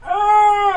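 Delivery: loud high yelp of alarm; sharp startled shout; overwhelmed cry. Retold or imitated. Overwhelmed by chaos in the visit room, a loud high yelp.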